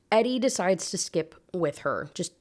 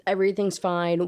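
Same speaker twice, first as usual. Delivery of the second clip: usual speaking voice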